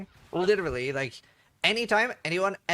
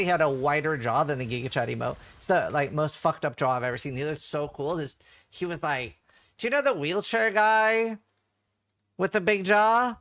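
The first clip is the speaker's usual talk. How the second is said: ordinary talk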